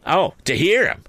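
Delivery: high, clapping cry; screaming cry. A narrator's reading. Sister cries out at Orlick, high and shrill. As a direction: cry out as she claps. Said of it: "cried my sister".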